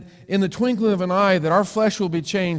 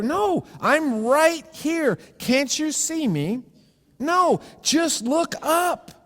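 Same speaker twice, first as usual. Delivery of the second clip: much higher, slow insistence; patient sphere; 8 semitones higher, slower than usual. Acted. Drawn-out high insisting call. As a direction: sphere tells the square to just look up, high and slow.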